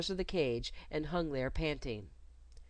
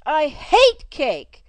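much higher, much louder, slow furious cry; angry outburst; 10 semitones higher, 16 dB louder, much slower than usual. Acted. Girl refusing the governess's cake, angry outburst. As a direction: blurt it out in a childish rage. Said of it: "burst out Gwendolyn".

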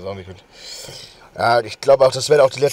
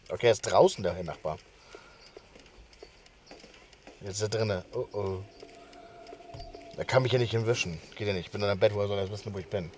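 softer hushed voice in hiding; whispering while hiding; 6 semitones lower, 12 dB quieter than usual. Real, not acted. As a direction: keep it down so the neighbor won't hear. Hiding in the bed.

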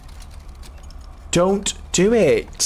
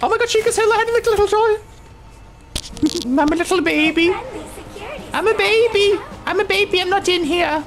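put-on high, squeaky baby voice; high-pitched pretend baby; mock baby voice; faster than usual. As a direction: play a tiny baby hiding. Hiding, baby voice to himself.

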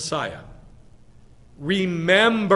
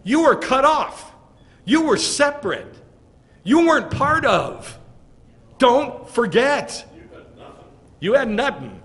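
higher, louder, hammering each short line; harsh preaching; 8 semitones higher, 6 dB louder than usual. Acted. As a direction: preach it hard like Paul scolding Gentiles. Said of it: "This is how he spoke".

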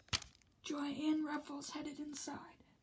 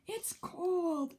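shivering; 3 semitones higher, 5 dB louder than usual. A narrator's reading.

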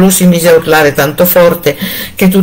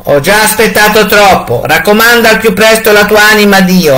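cruel husband; 5 semitones higher, 3 dB louder than usual. A narrator's reading. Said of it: "rispose il crudele marito".

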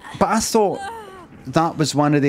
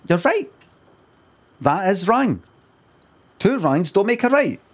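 higher-pitched voice of someone objecting; imagined objector; 6 semitones higher than usual. Acted. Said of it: "somebody could then say".

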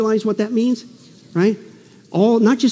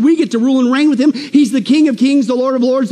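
ordinary delivery

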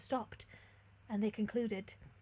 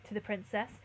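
ordinary delivery

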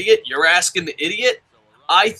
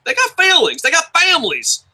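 put-on JR southern accent; 14 semitones higher, 4 dB louder than usual. Host quoting a commentator in defense of the other hosts, southern accent. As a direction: cry it out indignantly in a southern twang. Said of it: "quote J R in a southern accent".

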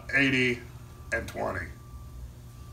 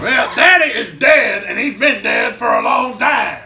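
loud laughing retort; 9 semitones higher, 7 dB louder than usual. Acted. Laughing retort about dead father.

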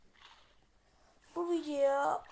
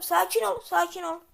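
frantic pleading; 4 semitones higher, 11 dB louder than usual. Real, not acted. Pleading with attacking zombies.

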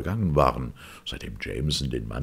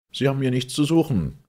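much higher and louder, near panic; desperate shout; 12 semitones higher, 7 dB louder than usual. A narrator's reading. Boyle shouts desperately at the stranger, much louder. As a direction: yell it out before you know what you're saying. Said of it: "rief Boyle verzweifelt".

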